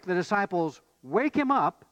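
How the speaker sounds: ordinary talk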